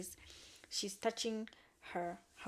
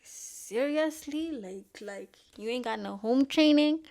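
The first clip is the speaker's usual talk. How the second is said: high, loud outraged disbelief; incredulous scolding; 4 semitones higher, 11 dB louder than usual. Retold or imitated. Vlogger scolding a rude character, loud and outraged. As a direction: scold her like a sassy auntie.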